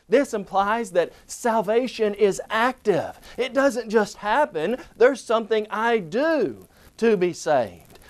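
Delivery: normal talking voice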